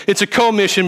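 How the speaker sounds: "commission" said as co-mission, with a full long "oh". Shown as a demonstration. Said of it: "because at the end".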